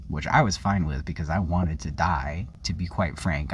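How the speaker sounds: ordinary delivery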